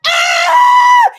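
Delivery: very high, loud scream of pain. Retold or imitated. Acting out a knight stabbed in the eye, screaming. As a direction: scream full out, long and piercing. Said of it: "He starts fucking screaming".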